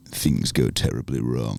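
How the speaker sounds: low, slow mock-ominous narrator; playful trailer-style doom delivery; movie trailer voice. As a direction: drop deep and draw out the menace. Teasing the film plot like a trailer.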